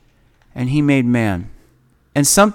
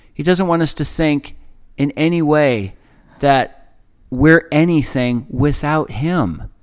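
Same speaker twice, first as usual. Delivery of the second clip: ordinary talk, nothing marked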